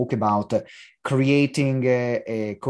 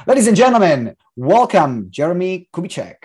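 loud, high-pitched guest announcement; show announcer; 6 semitones higher, 6 dB louder than usual. Real, not acted. Host welcoming the guest to the show, announcer style. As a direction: introduce the guest like a ringmaster, voice lifted.

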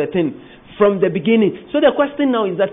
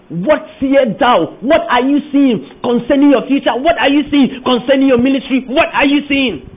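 emphatic preaching; 7 semitones higher, 5 dB louder than usual. Real, not acted. Preacher voicing God's question.

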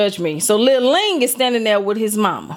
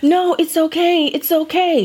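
higher, slow soothing mom voice; mom excusing; 7 semitones higher, slower than usual. Retold or imitated. Ling's mom excusing the slap, higher and slow. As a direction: wave it off gently, slow and high. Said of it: "And so Ling's mom is like".

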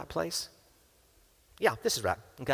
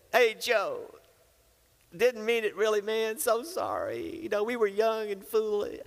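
higher, sheepish backpedaling apology; sheepish excuses; 9 semitones higher than usual. Acted. Brothers making excuses to Joseph, sheepish and higher. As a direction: mumble weak excuses to the brother you wronged. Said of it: "What were they making, excuses".

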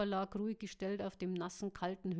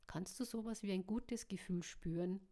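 plain reading voice

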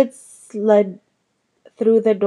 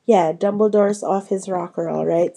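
ordinary delivery, nothing marked

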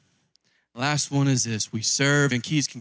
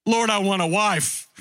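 higher, whiny mock prayer to the Lord; mock needy prayer; 5 semitones higher than usual. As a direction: plead like a spoiled believer, half-joking.

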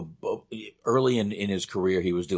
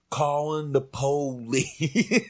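drawn-out, higher comic delivery with stretched syllables; imitating John Witherspoon; 6 semitones higher, much slower than usual. Co-host mimics the actor's line from the movie, drawn-out imitation. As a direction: stretch out "po-lice" slowly, breaking into a laugh. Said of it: "He said".

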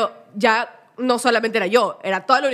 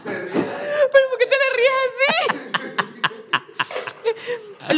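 laughing while talking; 11 semitones higher, slower than usual. Real, not acted. Laughing at friend's reaction.

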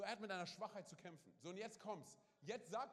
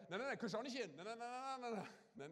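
higher and louder hammed-up no no no; mock panicked denial; 4 semitones higher, 4 dB louder than usual. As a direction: play the man hurrying to hide his weakness. Acts man hiding his weakness.

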